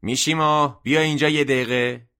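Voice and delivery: loud, high call across the shop; shouting a name. A narrator's reading. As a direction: call him up from the cellar, loud. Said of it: "داد زد".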